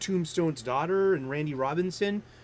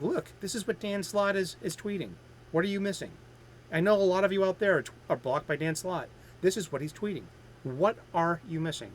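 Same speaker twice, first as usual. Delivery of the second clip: ordinary delivery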